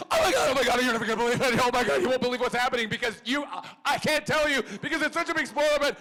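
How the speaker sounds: high, loud, breathless squealing; overexcited gushing. Retold or imitated. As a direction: gush like you can't hold the secret in. David bursting in with news.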